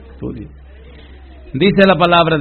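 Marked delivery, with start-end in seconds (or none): 0.1-0.5 soft firm repeat. Shown as a demonstration. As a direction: say "Estudie" quietly, final.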